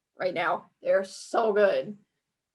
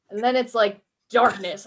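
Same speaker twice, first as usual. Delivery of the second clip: ordinary delivery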